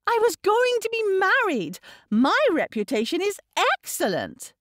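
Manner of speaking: put-on high-pitched, affronted girl; haughty indignation. A narrator's reading. Girl defending her reputation to her father, haughty. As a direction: defend yourself, nose in the air.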